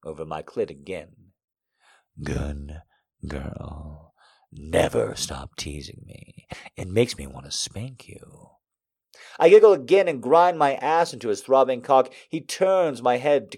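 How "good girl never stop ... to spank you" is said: slow, low, soft man's voice; low male lover. A narrator's reading. Man praising his teasing lover, low and slow. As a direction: drop low and quiet, take it slowly.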